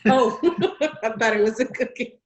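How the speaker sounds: laughing through a quick reply; real laugh, words tumbling out quickly; amused laughing. Real, not acted. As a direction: chuckle at your own mistake. Laughing at cookie mix-up.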